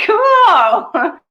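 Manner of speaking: high, loud laughing cool; a delighted laughing cool. Real, not acted. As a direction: laugh it out brightly. Laughing exclamation after quote.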